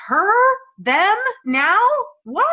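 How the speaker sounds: playful pretend astonishment; mock disbelief. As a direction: act out stunned onlookers. Imagined onlookers in disbelief.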